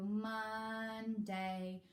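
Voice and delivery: slow, two separate beats; split syllables. Shown as a demonstration. Showing parents the split-word way, slowly. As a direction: pull the two parts apart. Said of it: "For two syllable words".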